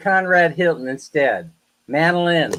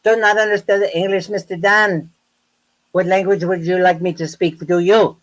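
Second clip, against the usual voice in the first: higher, sneering mimic of the landlady, stammering the m's; mocking landlady; 4 semitones higher than usual. Retelling how the landlady mocked his English, sneering mimicry. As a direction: parrot her taunting voice back at her. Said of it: "she talked to me like that".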